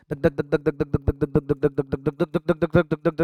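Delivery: quick rhythmic dhak dhak like a racing heart; rapid heartbeat imitation. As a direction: rattle off the heartbeat sounds fast. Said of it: "मैं कितनी तेज बोल रहा हूँ".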